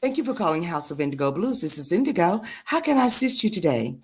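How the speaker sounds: softly polished receptionist greeting; quiet secretary-style greeting; business phone voice. Shown as a demonstration. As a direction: answer the office line, hushed and courteous. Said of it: "next time you call me".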